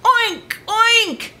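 high, loud piggy oinks. Acted. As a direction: oink like a squealing pig. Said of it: "When the pigs went".

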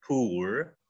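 American POOR, drawn out as poo-er with an r; American reading. Shown as a demonstration. Coach showing the class an American pronunciation. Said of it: "Kalau American ya".

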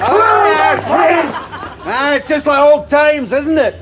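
forced jolly. Acted. Gavin forcing cheer at the reunion. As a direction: overdo the delight to cover discomfort.

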